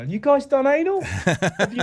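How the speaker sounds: loud, high, blurted question; mock loud blurt. Comedian blurting a crude question to strangers.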